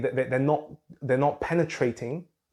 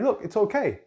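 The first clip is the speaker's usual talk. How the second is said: higher, brushing-off "Look, it's okay"; mock parent telling the child to stop fussing; lightly hammed impatient parent; dismissive it's okay; 7 semitones higher than usual. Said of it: "Do we just".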